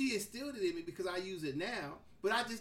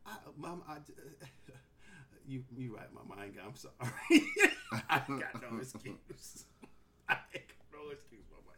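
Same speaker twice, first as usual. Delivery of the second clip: caving to mama with a giggle; sheepish laughing apology; 8 semitones lower than usual. Retold or imitated.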